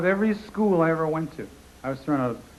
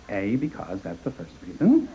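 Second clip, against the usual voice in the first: mother logic; 5 semitones lower, slower than usual. Retold or imitated. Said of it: "my mother said".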